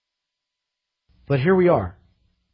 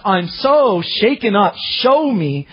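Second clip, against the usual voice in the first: urgent request; 5 semitones higher, 4 dB louder than usual. Acted. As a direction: beg for guidance with fervour. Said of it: "you hear his request".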